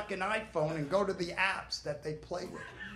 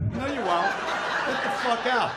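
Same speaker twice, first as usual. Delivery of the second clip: put-on louder, snapping parent kicking the kid out; angry parent; 3 semitones higher, 5 dB louder than usual. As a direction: throw the freeloader out of the house.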